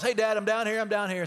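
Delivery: high, loud call from far below; boy's loud reply from the beach. Retold or imitated. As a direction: shout up to your dad across the beach. Son calling out from beach.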